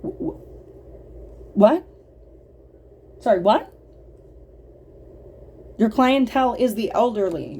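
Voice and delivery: sharp disbelieving what; stunned disbelief. Real, not acted. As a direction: react like you misheard something outrageous. Sudden disbelief at the story.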